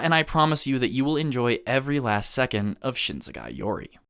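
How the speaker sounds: usual speaking voice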